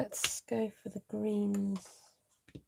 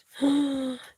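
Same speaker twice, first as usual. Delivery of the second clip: gasp; 4 semitones higher than usual. Real, not acted. Reading of Cindy's fall.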